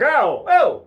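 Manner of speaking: high, loud yelps of disgust; hammed-up startled cry; shocked recoil. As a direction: yelp as if pulling away from a kiss. Recoils from surprise French kiss.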